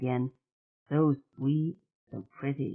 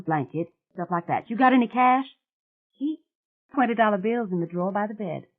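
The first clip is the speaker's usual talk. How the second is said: put-on higher and louder, bossy caring young woman; Mary Jane taking charge; 6 semitones higher, 6 dB louder than usual. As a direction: order her to sit, brisk and firm. Mary Jane tending fainting Mona.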